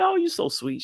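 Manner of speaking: high mock wife voice, gushing; wife's voice. Husband imitating his delighted wife, high. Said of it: "And she's like".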